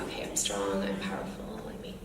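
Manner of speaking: very soft, barely voiced reluctant try; hesitant girl's attempt. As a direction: imitate a child trying it timidly. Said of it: "And she was like".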